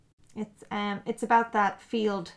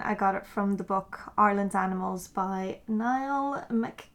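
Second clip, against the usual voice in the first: ordinary talk, nothing marked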